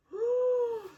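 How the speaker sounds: sharp high gasp of shock; dramatic gasp. Retold or imitated. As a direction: suck in air in disbelief. Shocked reaction to the reveal.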